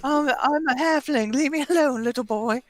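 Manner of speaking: high, small voice telling someone off; little halfling woman fending off a boy; indignant halfling. Acted. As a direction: squeak out an indignant brush-off. Plays offended halfling servant girl.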